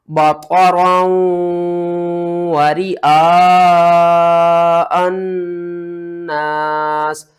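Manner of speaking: Quran recitation. Shown as a demonstration. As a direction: draw out رِئَاءَ in full recitation. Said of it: "تو کس طرح پڑھنا ہے؟".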